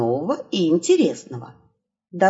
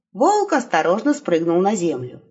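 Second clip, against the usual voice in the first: ordinary delivery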